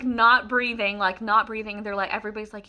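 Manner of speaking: ordinary talk, nothing marked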